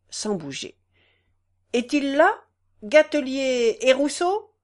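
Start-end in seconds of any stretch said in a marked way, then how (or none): 1.7-4.5 louder call into the room. A narrator's reading. Clerk calling for the two peasants, louder. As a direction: call out for the parties.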